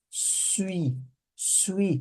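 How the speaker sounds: suis said as one quick swee glide, softly; teacher demonstrating French suis. Shown as a demonstration. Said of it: "como se fosse falar suíço".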